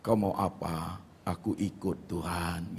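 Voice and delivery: soft, low, drawn-out submission; surrendered prayer. Retold or imitated. Preacher repeats his spirit's answer to God, softly surrendered. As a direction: yield to God slowly and quietly. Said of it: "manusia rohku yang merespon".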